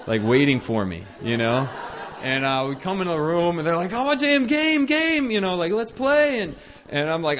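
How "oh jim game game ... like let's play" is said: imitating kids clamoring, high and loud; loud, high mock kid whine begging for attention; excited kids' pleading. Children pestering their tired caretaker for a game, excited.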